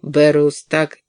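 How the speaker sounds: ordinary delivery, nothing marked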